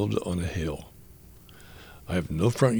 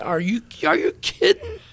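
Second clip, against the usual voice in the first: much higher, startled question; amazed disbelief. Retold or imitated. Mother stunned her son wants to dig again, high amazed question. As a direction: squeak it out in disbelief.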